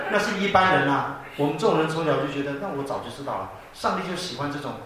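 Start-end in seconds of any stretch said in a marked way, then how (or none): 2.6-3.5 lower, softer, been-there-done-that aside; jaded shrug. Retold or imitated. Mimicking bored church kids hearing a testimony, jaded mutter. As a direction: toss it off as old news.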